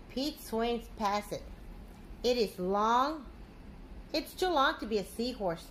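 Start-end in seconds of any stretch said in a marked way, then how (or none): none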